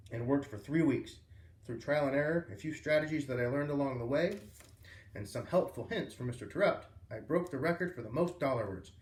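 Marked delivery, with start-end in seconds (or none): none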